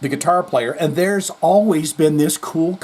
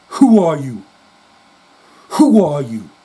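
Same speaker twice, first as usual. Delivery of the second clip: louder, irritated demand; agitated; 6 dB louder than usual. Retold or imitated. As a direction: snap back at the pushy stranger. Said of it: "he looks like he's agitated".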